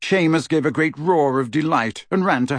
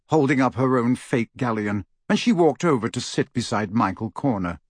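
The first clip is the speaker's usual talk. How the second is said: usual speaking voice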